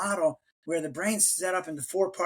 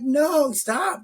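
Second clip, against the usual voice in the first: mock yell; 8 semitones higher, 5 dB louder than usual. Acting out road rage.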